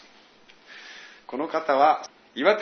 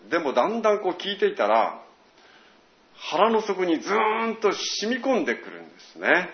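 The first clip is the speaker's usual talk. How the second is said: ordinary delivery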